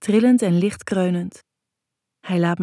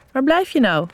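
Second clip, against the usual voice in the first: higher, louder call over the phone; impatient boyfriend; 7 semitones higher, 5 dB louder than usual. Acted. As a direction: snap a little, he's been waiting. Bas belt ongeduldig op. (ongeduldige vriend aan de telefoon)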